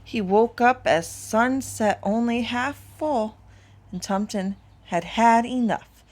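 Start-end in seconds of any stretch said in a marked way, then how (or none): none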